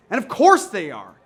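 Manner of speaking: normal talking voice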